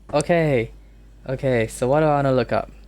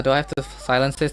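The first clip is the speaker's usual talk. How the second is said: ordinary talk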